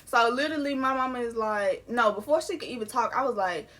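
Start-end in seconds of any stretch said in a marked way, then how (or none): none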